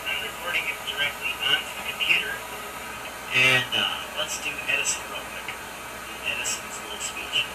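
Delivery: thin, hissy carbon mic recording; gritty early microphone. Real, not acted. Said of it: "except for the carbon hiss".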